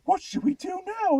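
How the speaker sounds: mock character voice.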